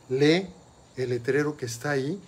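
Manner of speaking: ordinary talk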